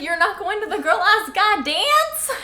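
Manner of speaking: high, laughing dad voice; imitating Charlie. Imitating Charlie quizzing Bella, high and laughing. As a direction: tease like a clueless dad. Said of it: "Charlie's like".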